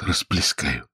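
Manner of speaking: soft, feeble groan; moaning. A narrator's reading. Prisoner fears spilling the drink, softly moaning. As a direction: let it out weakly, softly. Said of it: "простонал кот".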